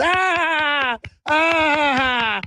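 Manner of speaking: crowd cheering. Retold or imitated. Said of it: "The crowd goes".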